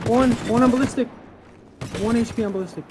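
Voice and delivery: high shouted callout; combat callout. Real, not acted. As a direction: yell the enemy's health. Fight callout.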